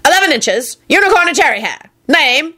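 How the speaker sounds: high-pitched officious bark; barking warlock. A narrator's reading. Warlock at the desk checking a wand, barked. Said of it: "he barked".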